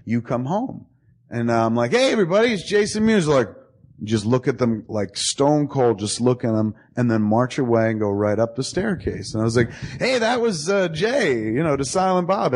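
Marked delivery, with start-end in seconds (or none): 1.9-3.3 high, sing-out party announcement; announcing to guests. Retold or imitated. Host introduces friend to party guests, announcing high. 10.0-12.5 high-pitched cover for the guests; mock party announcement. Host explains friend's odd exit to guests, high announcing voice.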